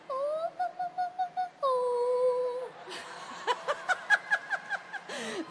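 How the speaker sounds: high, sustained operatic "ooh"; opera-style vocalizing. Pretend. Child singing on mother's request, opera-style vocalizing. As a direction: trill and hold high wordless opera notes. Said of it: "Opera singer pala to".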